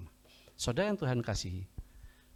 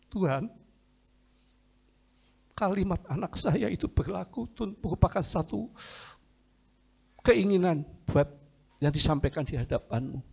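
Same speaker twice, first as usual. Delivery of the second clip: high, pleading voice to God; retold tearful plea, pitched up; tearful prayer; 7 semitones higher than usual. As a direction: pray as if holding back crying. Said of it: "Di toilet saya menangis".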